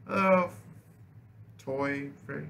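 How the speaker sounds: ordinary delivery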